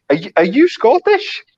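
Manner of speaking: high-pitched mock disbelief; retold surprised question. Friend reacting to a fake Scottish voice note, high mock surprise. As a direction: imitate a baffled friend, voice jumping up. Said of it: "Resisting the Lies was like".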